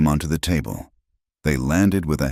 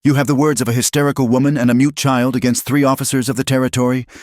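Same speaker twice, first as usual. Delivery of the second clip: frantic shout; 9 semitones higher, 4 dB louder, faster than usual. A narrator's reading. Crowley screams accusation.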